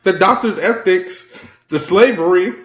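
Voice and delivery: high-pitched, incredulous rebuttal; worked-up comeback; indignant protest. Real, not acted.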